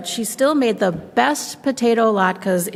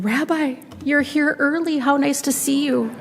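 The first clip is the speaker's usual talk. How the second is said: put-on a higher, sweet old granny voice; old woman welcoming a guest at the door, higher and sweet; old grandmother voice; 6 semitones higher than usual. Baba Brena greets the rabbi.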